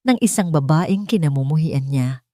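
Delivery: usual speaking voice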